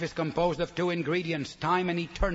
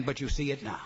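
usual speaking voice

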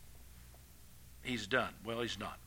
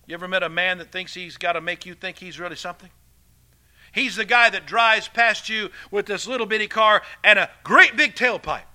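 ordinary delivery

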